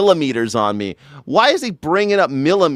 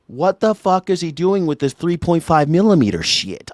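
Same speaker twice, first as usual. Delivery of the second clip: ordinary talk, nothing marked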